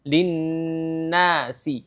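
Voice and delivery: Linnasi very slow, nn and a each held long; slow model of linnasi; held nun. Shown as a demonstration. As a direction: draw out Linnasi in two counts. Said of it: "sama-sama dua harakat".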